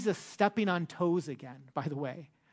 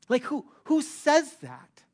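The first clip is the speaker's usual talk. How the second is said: high, louder scoffing question; incredulous; 8 semitones higher, 5 dB louder than usual. Pretend. Preacher voicing the Pharisees' disbelief, high scoff. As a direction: scoff at the nerve of it.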